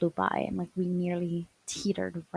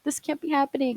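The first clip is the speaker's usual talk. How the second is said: disbelief; 7 semitones higher, 4 dB louder, faster than usual. Retold or imitated. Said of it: "looking up at her".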